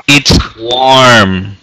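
it's warm stretched slowly; patient slow model. Shown as a demonstration. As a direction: draw out warm for the student. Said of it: "repítelas conmigo".

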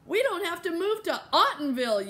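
high, loud whoop of relief; excited yell. A narrator's reading. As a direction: yell the good news out. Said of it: "yelled Oliver".

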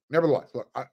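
normal talking voice

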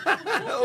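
high laugh. Real, not acted. Laughs at joke.

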